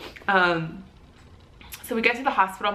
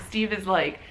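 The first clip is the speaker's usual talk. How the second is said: ordinary talk, nothing marked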